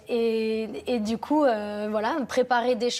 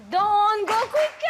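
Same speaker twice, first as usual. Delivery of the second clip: high, loud and slow instruction; high coaching call; 10 semitones higher, 4 dB louder, slower than usual. Real, not acted. Choir teacher slowing down her singers, high and loud. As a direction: rein the singers back, loudly.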